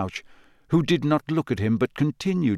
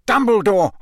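high, loud desperate gasp; 8 semitones higher, 6 dB louder than usual. A narrator's reading. Crazed man clutching for help, gasping loudly. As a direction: gasp the name out urgently. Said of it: "gasped Mister Crouch".

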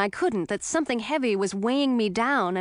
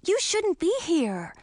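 Emma's voice; 8 semitones higher, slower than usual. A narrator's reading. Emma warns Ralph.

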